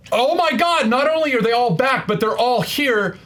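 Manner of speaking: high-pitched, excited outburst of awe; amazed fan reaction. Acted. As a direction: gush it high, thrilled they are all here. Voices awed reaction to the moment.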